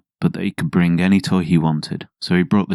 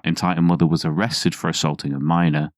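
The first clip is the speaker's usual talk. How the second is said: ordinary delivery, nothing marked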